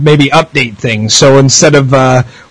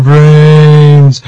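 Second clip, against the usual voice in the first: zombie; 3 dB louder than usual. Pretend. Zombie moan after moaning talk.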